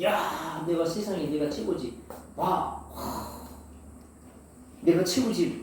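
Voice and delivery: mock bragging, chest puffed out; boastful swagger. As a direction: play the show-off who thinks he's the best. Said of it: "잘난 체를 해".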